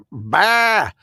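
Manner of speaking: loud high bleat; mock sheep bleat. Mocking masked people as sheep, loud bleat.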